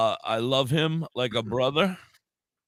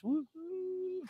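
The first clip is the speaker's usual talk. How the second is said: playful woo-hoo; 12 dB quieter than usual. Real, not acted. Celebrating being high.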